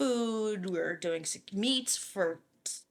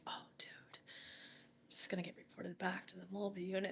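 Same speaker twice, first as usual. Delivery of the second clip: voiceless, much softer, to himself; dreading whisper; 14 dB quieter than usual. Retold or imitated. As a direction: whisper your doom to yourself. Dread at being reported.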